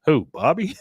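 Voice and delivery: baffled, half laughing; surprised. Retold or imitated. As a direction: Hank puzzled at praise for his son. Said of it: "Hank's like".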